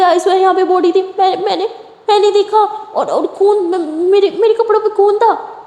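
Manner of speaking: high, stammering protest; panicked insisting. A narrator's reading. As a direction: swear it was there, flustered.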